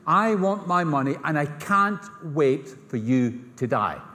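slow, deliberate, word by word; blunt demand. Acted. Son tells his father he wants his money, blunt and slow.

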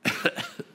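soft little cough; acted cough. Artist behind the husband interrupts, acted discreet cough. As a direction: give a small attention-seeking cough. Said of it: "he heard a cough behind him".

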